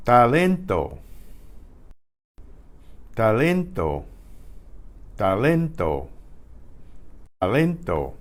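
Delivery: "Talento", clean T, pure vowels; Spanish unaspirated T. Shown as a demonstration. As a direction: model "Talento" for the class. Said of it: "The T in English is, is breathed".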